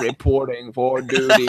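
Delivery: slow, lightly voiced dutiful soldier. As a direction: report in like a stiff little soldier. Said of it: "he'd be like".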